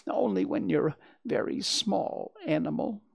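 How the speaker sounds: high, plaintive small-animal voice; timid, mildly sorry for oneself; slightly tearful. A narrator's reading. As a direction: plead smallness meekly. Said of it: "sniffling s-, slightly".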